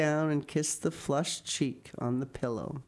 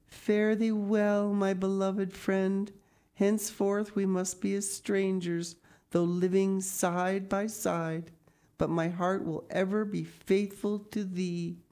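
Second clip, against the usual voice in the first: Anne's solemn farewell; 5 semitones higher than usual. Acted. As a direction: intone it like a tragic heroine.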